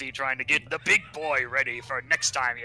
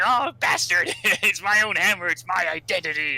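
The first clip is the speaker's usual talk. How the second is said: higher, louder mock Karen outburst, with a laugh; Karen-style rant; 5 semitones higher, 4 dB louder than usual. Amy throwing a Karen fit at Sonic over her hammer, loud and mock-whiny. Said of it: "going all Karen on his ass".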